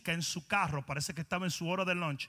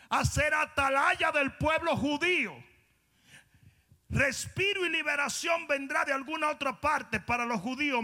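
louder, slow, fervent preaching rising to a shout; slow emphatic shouted preaching; building sermon; 8 semitones higher, 7 dB louder, slower than usual. Acted. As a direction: build it to a climax.

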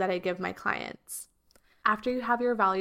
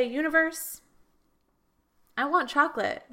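asking the universe; 6 semitones higher than usual. Retold or imitated. Speaker asks the universe for what she wants, hopeful call. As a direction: call up to the sky with a wish.

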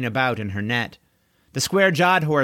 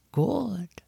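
soft breathy whispered good; soothing whisper; 8 semitones higher, 5 dB quieter than usual. Acted. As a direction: whisper it gently to calm her. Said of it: "in a soothing tone".

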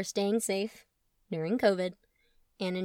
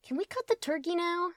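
put-on kid voice; 9 semitones higher than usual. Kid voice Truman.

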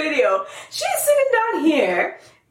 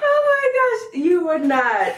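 laughing through the words; 6 semitones higher, slower than usual. Real, not acted. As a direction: crack up while talking. Laughing hard.

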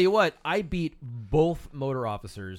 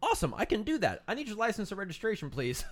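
mock delight, much higher, sing-song; overly chipper sarcastic officer; sarcastic cheer; 7 semitones higher than usual. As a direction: fake enthusiasm before the license request. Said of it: "my response was something to the effect of".

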